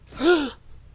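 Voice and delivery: a sharp, high, scandalised gasp; shocked high gasp. Acted. A stranger's offer makes him gasp in high shock. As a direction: gasp as if deeply offended.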